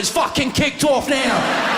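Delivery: loud, high, slow shout; excited shout. Retold or imitated. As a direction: yell it as the fight starts outside the ground. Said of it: "He's going".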